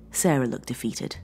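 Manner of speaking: plain reading voice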